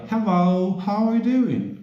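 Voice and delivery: high, lifted English hello; bright English greeting. Shown as a demonstration. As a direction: greet the class in a high sing-song English voice.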